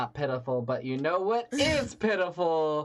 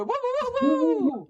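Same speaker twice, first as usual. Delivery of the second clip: whoops climbing much higher and louder; cheering woo; 5 dB louder than usual. Real, not acted. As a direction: cheer the comic onto the stage. Host cheering next comic on.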